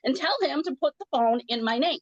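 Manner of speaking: worked-up, insistent flood of words; retold angry rant. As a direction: rant as if flipping out on the phone. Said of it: "I just got really angry".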